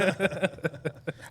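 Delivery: high, staccato laughing; real burst of high-pitched laughter. Real, not acted. Laughing after political outburst joke.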